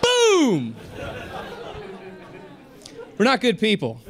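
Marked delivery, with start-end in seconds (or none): 0.0-0.8 higher punchy boom; comic's triumphant boom. Real, not acted. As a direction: slam the punchline home. Punchline tag, slapped out.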